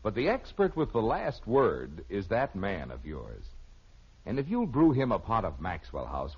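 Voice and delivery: ordinary delivery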